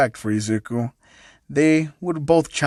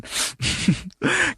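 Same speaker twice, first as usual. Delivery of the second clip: high giggle; 5 dB quieter than usual. Real, not acted. Laughing at own excuse.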